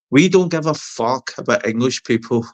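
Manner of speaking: usual speaking voice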